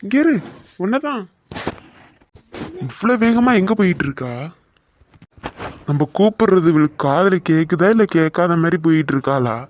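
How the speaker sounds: loud, insistent calling; shouting after her. Acted. Boy loudly calling Sandhya to stop. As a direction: yell so she can't ignore you.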